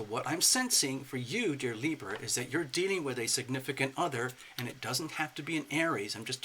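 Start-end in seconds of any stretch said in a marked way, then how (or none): none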